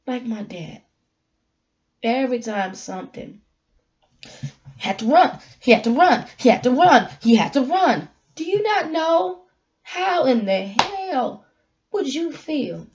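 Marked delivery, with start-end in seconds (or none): 4.8-8.1 louder, punching each run; emphatic repetition. Real, not acted. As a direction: hammer the word run every time. Repeating the phrase about her dad.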